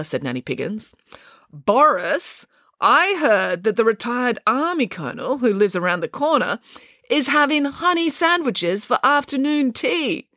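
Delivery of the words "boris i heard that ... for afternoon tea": high, enticing storybook voice; tempting, high-pitched coaxing that slows at the end. A narrator's reading. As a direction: dangle the treat to lure a sleeper up. Nanny coaxes brother with honey bait.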